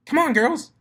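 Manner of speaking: very high, loud squeal; excited squeal. Acted. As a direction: squeal it, bouncing with glee. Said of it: "squealed her pink friend".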